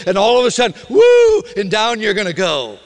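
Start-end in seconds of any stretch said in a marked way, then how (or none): 0.9-1.4 high, loud "woo" as if slipping. Acted. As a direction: let out a loud, high whoop of a sudden slip. Imitates the slip in falling.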